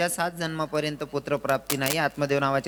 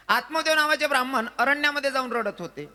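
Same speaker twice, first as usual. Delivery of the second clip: high, sing-song kirtan storytelling; kirtan preaching; 9 semitones higher than usual. Acted. Preacher telling a sad story to the crowd, high and sing-song. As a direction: preach the tale at full force, lifting high.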